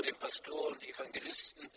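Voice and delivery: normal talking voice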